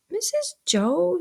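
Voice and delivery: put-on slow, high-pitched boy; child's appeal. A narrator's reading. Pip appeals to his sister, tentative child.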